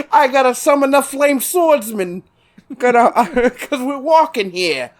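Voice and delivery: put-on high, laughing Brooklyn accent; mock Brooklyn anime voice; Joey Wheeler. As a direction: ham up a brash Brooklyn accent. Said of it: "Joey Wheeler in his Brooklyn accent".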